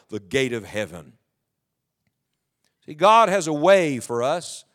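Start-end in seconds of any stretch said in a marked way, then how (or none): 0.1-1.1 "the gate of heaven." softened and dropped low; quiet repeat. Shown as a demonstration. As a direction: murmur "the gate of heaven." as an afterthought. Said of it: "mark that phrase in your Bible".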